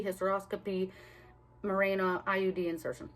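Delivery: ordinary talk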